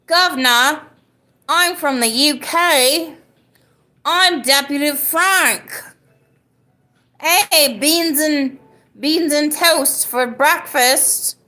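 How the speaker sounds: high, drawn-out hammy British accent. Shown as a demonstration. Streamer showing a UK viewer a British accent, hammy and high. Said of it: "let me do my best British accent".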